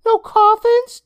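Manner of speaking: put-on high, loud boyish voice, hopeful; hope hard for a no, kid voice. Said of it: "Day Day asked hopefully".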